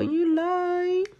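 high, teasing diva tone; sassy high voice. Pretend. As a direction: toss it off with sass. But you do whatever you like.

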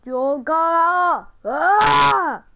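loud, drawn-out cartoon yell; mock battle cry. Gamer imitating an animated movie guy, loud mock yell. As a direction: holler it like an animated hero, stretching the vowels. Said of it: "He's like".

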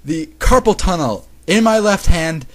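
slow, loud, word by word; loud, drawn-out mock outrage; exasperated outburst. Real, not acted. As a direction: punch out each word in disbelief. Reader reacts aghast to the story.

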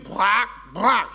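drawn-out imitation croaks underfoot; frog noises. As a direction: croak like frogs being trodden on. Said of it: "דורך על צפרדעים".

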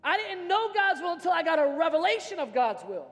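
usual speaking voice

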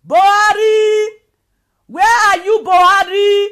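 long, loud crying-out of Buhari; calling out loud. Real, not acted. Ranting to Buhari on a live video, a loud anguished call. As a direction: call out to him across a distance, anguished.

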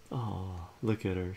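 usual speaking voice

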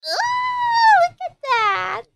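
high, loud squealing burst; excited squeal. Real, not acted. Fan squealing at the anime couple on screen, high and loud. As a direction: shriek with delight at the screen.

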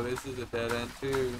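ordinary talk, nothing marked

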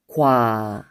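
과 with a low falling gwa; 과 not 와. Shown as a demonstration. Teacher modeling the particle, gwa said low. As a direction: say 과 clearly as gwa. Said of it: "not 와".

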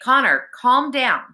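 high, firm scolding; insistent. A narrator's reading. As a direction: insist that he settle down. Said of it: "Alex insisted".

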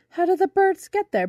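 put-on high, loud, excited boy; eager question. A narrator's reading. Boy ready to start asks bumblebee, eager. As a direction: ask eagerly, pitched right up.

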